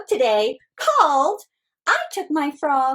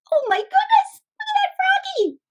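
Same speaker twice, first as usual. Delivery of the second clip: shocked librarian. Acted.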